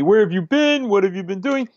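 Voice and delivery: high, loud angry interrogation, building; mock scream, climbing in pitch; screaming boss. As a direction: act out a furious senior partner. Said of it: "He's screaming at me".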